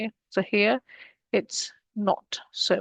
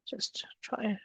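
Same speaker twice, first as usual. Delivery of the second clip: whispered aside; 14 dB quieter than usual. Real, not acted. Whispered aside while scrolling.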